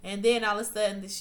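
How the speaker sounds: ordinary delivery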